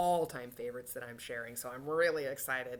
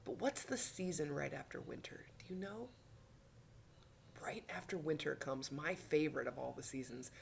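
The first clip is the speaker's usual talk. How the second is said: hushed, secretive question; whisper; 7 dB quieter than usual. Shown as a demonstration. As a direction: lean in and whisper. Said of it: "whispered question to children".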